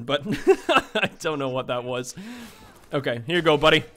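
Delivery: ordinary delivery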